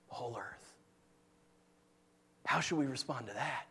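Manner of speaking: low, soft, reverent hush; hushed preaching. Real, not acted. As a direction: let it drop to near-whisper awe.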